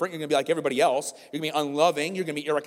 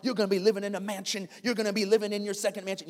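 higher, breathless hype-preacher parody; mocking prosperity preacher; 5 semitones higher than usual. Pastor parodying prosperity gospel for his church, hammed-up preacher. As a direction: sell the mansion dream, hammed up. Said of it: "I'm making fun of that".

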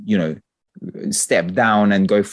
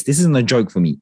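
normal talking voice

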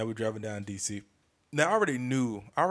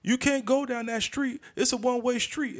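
mocking yell; 14 semitones higher, 4 dB louder than usual. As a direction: squeal it like a nerdy busybody. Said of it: "he's yelling out".

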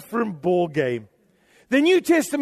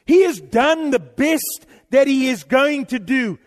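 ordinary talk, nothing marked

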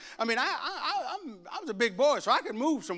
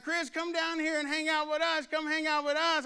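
higher, calling out from the pool; friends calling; 7 semitones higher than usual. Retold or imitated. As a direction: wave him over like excited kids. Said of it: "my friends would be like".

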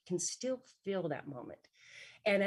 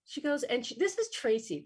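ordinary talk

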